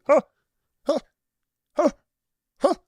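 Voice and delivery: evenly spaced, short repeated "huh"; self-made echo. Retold or imitated. Admitting the echo was him, mock echoing huhs. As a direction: mimic yourself as your own echo. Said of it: "that echo was probably me".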